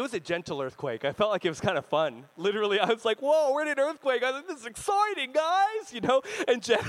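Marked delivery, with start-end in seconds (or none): 3.2-5.9 high, giddy retold thrill; mock excitement. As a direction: act out childlike delight at the shaking. Retells his delight at earthquake.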